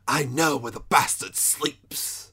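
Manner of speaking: slow, mock-dramatic movie line delivery; Keanu Reeves impression. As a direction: imitate a film actor's rough accent, drawn out. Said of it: "that line when he's like".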